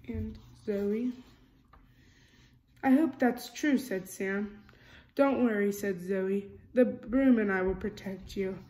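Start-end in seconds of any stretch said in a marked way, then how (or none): 2.8-8.6 lively storybook reading, character lines a bit louder; reading to children. A narrator's reading. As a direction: read it like a bedtime picture book. Reading picture book aloud.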